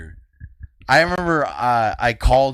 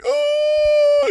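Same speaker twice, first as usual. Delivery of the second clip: very high shouted fake sob; hammed-up crying yelp; fake wail; 4 dB louder than usual. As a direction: let out a shrill mock wail. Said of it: "I was doing this".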